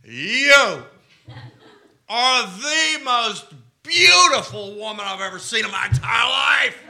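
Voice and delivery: put-on loud, high, slurred drunken declaration; drunk slurring. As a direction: slur it like a tipsy man shouting his love. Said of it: "but slurred".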